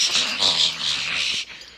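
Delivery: long hissing spray sound; imitated spray hiss. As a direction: mimic frantic spraying with a drawn-out hiss. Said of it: "try to spray real quick".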